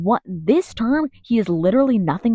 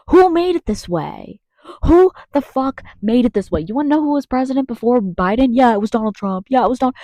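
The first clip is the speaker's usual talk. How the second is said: high, loud, rapid-fire indignation; heated; 5 semitones higher, 4 dB louder than usual. Real, not acted. Asking voters who ruined America, heated. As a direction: demand answers in a raised, heated voice. Angry rhetorical questions to voters.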